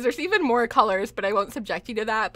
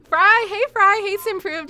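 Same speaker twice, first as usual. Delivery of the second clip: higher and louder, calling out names; excited greeting; 10 semitones higher, 8 dB louder than usual. Real, not acted. Streamer calling out to chat, excited and laughing. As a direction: call out over laughter.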